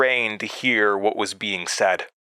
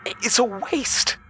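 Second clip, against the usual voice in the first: very high, halting, breathless pleas; strained and gasping. A narrator's reading. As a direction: gasp the words out while being choked. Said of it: "strained and gasping".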